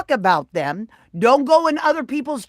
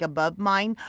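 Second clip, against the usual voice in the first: ordinary talk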